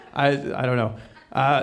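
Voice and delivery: usual speaking voice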